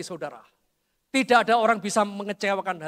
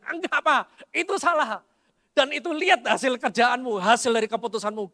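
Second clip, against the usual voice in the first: higher, pushy voice of the son; insistent son; 4 semitones higher, 4 dB louder than usual. Retold or imitated. Son to his father, arguing back with a raised voice. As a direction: push back hard like a stubborn young man. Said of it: "Anak saya ngotot dan dia bilang".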